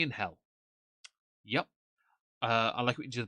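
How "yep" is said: ordinary talk, nothing marked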